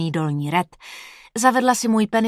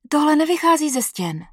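put-on girlfriend's voice; 8 semitones higher than usual. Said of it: "řekla".

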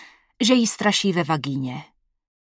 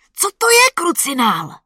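much higher, loud startled cry; shouted; 10 semitones higher, 6 dB louder than usual. Acted. Boyfriend yelling in fright at the ghost's shriek. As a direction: yell it out in fright. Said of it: "vykřikl jsem".

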